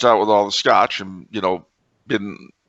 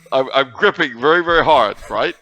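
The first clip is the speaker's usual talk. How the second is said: ordinary delivery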